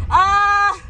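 short, high, loud cry of frustration; exasperated yell. Real, not acted. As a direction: burst out in frustration. Exasperated mid-sentence.

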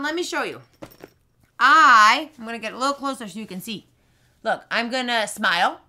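ordinary talk

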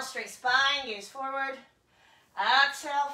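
ordinary talk

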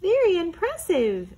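high, loud impressed exclaim. Acted. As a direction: gush with delight. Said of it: "exclaimed the Mouse".

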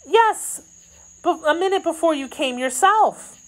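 high, quick maid's voice; anxious maid. Acted. Kitty telling of yesterday's visit, high and anxious.

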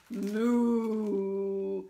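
low drawn-out moo; cow moo. Acted. Storyteller naming the cow to children, low imitated moo.

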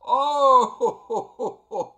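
loud, high outburst breaking into laughter; real laughing fit, voice leaping up; helpless laughter. Real, not acted. Player cracking up at the game's lines, helpless laughter. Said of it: "I'm laughing so hard".

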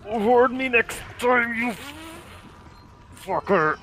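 drawn-out annoyed complaint at partner; annoyed slow scold. Real, not acted. Scolding his co-op partner for leaving him outside, slow annoyed drawl. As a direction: stretch it out, scolding.

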